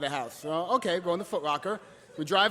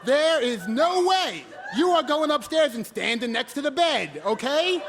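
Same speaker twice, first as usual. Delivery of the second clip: indignant outburst; 10 semitones higher than usual. Retold or imitated. Son stopping boyfriend outside the hospital, indignant yelling. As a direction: lay into him with exasperated force.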